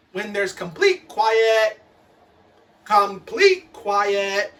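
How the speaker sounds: complete stressed, drawn out slowly; slow insistence. Acted. Teacher waits for silence, slow stressed complete. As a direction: lean on complete.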